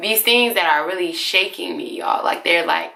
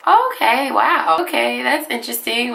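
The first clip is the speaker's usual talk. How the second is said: acted surprise; 6 semitones higher than usual. Acting her reaction to medium.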